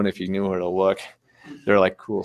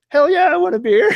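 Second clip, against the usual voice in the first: loud, very high whooping reply; excited holler; 6 dB louder than usual. Retold or imitated. Runner accepts a beer from jeep driver, hollering. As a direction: yell it back with total enthusiasm.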